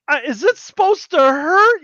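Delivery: high, loud puzzled question; mock bewilderment. Bewildered target of misfired spell.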